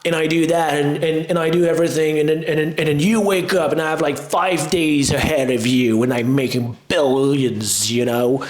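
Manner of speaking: a hint of a Southern hustle bro; mock Southern hustle guru. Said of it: "I don't know why my guy is Southern".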